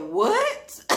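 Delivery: high loud stunned cry; shocked what. Retold or imitated. Hearing boyfriend did prison time, shocked high cry. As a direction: yelp it in disbelief.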